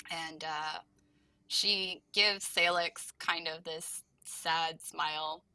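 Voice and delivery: ordinary talk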